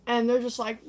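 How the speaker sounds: ordinary delivery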